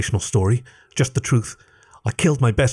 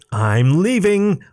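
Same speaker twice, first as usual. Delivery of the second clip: fed up; 7 semitones higher, much slower than usual. A narrator's reading. Fed up, quits the search.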